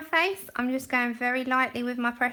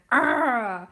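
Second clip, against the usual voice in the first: a loud mock "Arrgh" like a troll bursting into chat; imitating a chat troll's raw yell; 6 dB louder than usual. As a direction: bellow a silly "Arrgh" at the stream. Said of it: "on a stream going".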